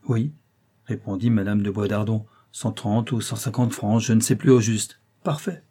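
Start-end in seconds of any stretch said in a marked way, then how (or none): none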